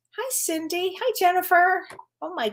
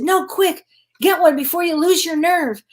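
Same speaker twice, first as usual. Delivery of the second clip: louder, higher mock-urgent voice; retold urgent urging; 6 semitones higher, 6 dB louder than usual. Psychologist mom urging her daughter back to couples work, retold urgently. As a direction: push the advice out urgently, imitating mom.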